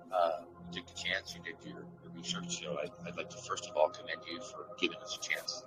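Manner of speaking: usual speaking voice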